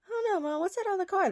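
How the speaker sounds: put-on very high, whiny kid voice; imitated child. Imitating the kid asking mom about the car, high whine. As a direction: mimic a worried little boy pleading with mom. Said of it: "The kid".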